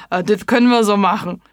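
quick, loud, laughing monster voice; amused agreement. Acted. Gryffalo agreeing to the mouse, amused and quick. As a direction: rattle it off still chuckling. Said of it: "musste schrecklich lachen".

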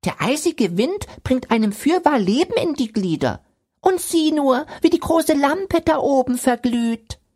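a snowman marvelling at the sunset. A narrator's reading. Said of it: "sagte der Schneemann".

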